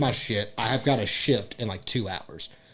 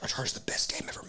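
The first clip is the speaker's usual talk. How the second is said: whisper; 7 semitones higher, 11 dB quieter, faster than usual. Retold or imitated. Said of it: "whispered quote of friend".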